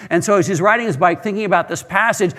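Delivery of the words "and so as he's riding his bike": ordinary delivery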